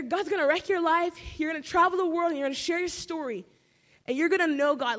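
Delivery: emphatic prophecy. Retold or imitated. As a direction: proclaim it with conviction.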